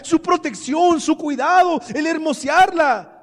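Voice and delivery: loud, high-pitched pulpit cry; full-force preaching. Real, not acted. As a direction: preach it loud and high, driving each phrase.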